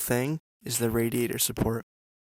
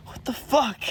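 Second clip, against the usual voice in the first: incredulous outburst; 11 semitones higher than usual. Real, not acted. Reacting to the broken part.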